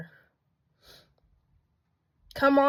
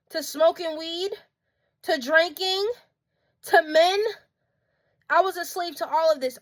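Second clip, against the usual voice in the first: higher, urgent preacher's call; fiery preaching; 6 semitones higher than usual. Acted. Calling out viewers about their slavery, fervent preaching. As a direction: testify like you're in the pulpit.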